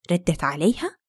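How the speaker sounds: ordinary delivery, nothing marked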